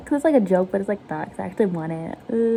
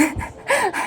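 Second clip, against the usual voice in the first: high laugh; 12 semitones higher than usual. Real, not acted. Laughs after damsel bit.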